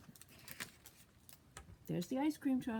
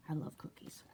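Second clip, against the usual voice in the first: whispered, much softer; 7 semitones lower, 9 dB quieter than usual. Real, not acted. Telling the children she loves cookies, whispering. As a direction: confide it in a whisper.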